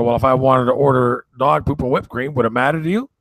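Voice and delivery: scoffing at the supplier; mock indignant. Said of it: "I go".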